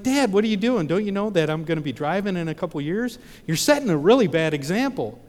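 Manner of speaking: high, loud exasperated protest at dad; alarmed teen protest. Retold or imitated. Teen scolding dad's driving.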